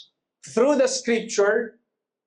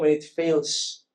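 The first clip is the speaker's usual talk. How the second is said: ordinary talk, nothing marked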